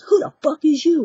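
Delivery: loud, high, angry mock yell; yelled challenge. Pimp challenging the girl who ran over, angry imitated yell. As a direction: bark it like a pimp confronting an intruder. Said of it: "he had already, you know, yelled at me once".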